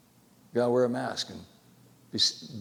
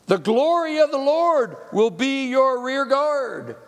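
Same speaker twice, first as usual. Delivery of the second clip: high, loud, slow preacher's proclamation; preaching at full force; 14 semitones higher, 9 dB louder, much slower than usual. Acted. Preacher proclaiming scripture to the congregation, full force. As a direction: thunder the promise out slowly.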